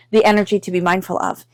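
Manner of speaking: ordinary delivery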